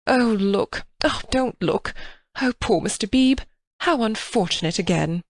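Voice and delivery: a hint of scandalised dismay; understated shocked mother; mild flustered exclamations. A narrator's reading.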